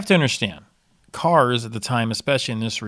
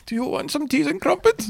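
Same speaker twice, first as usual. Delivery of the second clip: mock posh host.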